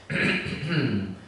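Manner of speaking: demonstrated nasal throat clear; throat clearing through the nose. Shown as a demonstration. Yoga teacher showing students how, throat cleared through the nose. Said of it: "You can do that again".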